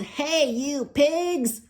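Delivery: slow, high, loud shout at the pigs; yell. Acted. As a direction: stretch the shout to make them run. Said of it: "and yelled".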